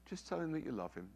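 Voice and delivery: soft, quick reply voicing God; quiet insistence. God replying to the father's inner argument, softly insistent. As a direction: answer the objections softly and simply.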